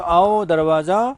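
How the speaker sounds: आओ दरवाजा slow and loud; slow emphatic translation. Shown as a demonstration. Teacher spelling out meaning slowly and loudly. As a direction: stretch आओ दरवाजा for the class. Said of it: "आओ का meaning देता है".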